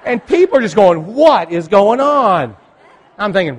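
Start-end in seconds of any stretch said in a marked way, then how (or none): 1.1-2.6 puzzled. Retold or imitated. Passengers puzzled.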